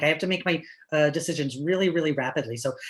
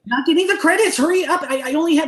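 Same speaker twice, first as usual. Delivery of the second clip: much higher, louder urgent yell; panicked yelling; 10 semitones higher, 9 dB louder than usual. Acted. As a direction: press mom to hurry. Son panics over credits.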